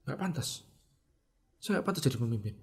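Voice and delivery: very soft, private admission; hushed self-reproach. Retold or imitated. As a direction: murmur it to yourself, defeated. Answering himself in nighttime reflection. (gumaman lirih menyalahkan diri)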